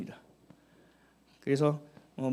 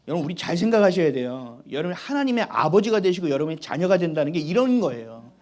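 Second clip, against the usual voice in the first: ordinary delivery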